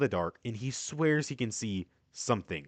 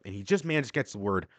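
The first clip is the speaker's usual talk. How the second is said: normal talking voice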